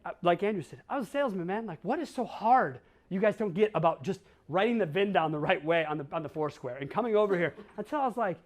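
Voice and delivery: ordinary talk, nothing marked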